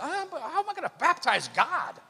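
imitating a flustered, high-pitched protest; mock freak-out. John balking at being asked to baptize God, freaking out. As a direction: play up a baffled, panicky objection. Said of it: "John kinda freaks out".

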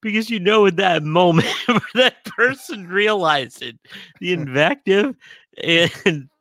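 high voice breaking into laughter; helplessly amused, laughing while explaining; real laughing. Real, not acted. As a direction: talk through hearty laughter. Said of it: "I'm laughing 'cause I relate".

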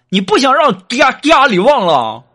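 a sharp, high, angry yell while striking; angry scolding. Retold or imitated. 锦凡打媳妇时质问. (高声怒斥)

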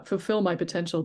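normal talking voice

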